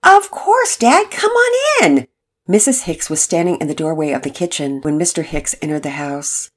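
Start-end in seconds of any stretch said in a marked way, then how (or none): 0.0-2.1 put-on higher, eager boy voice; a hopeful kid pleading with his mom; teen son. Son asking his mother, higher eager boy voice.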